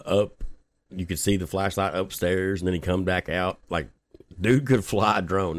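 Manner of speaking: normal talking voice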